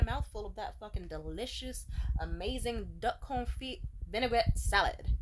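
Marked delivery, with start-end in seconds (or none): none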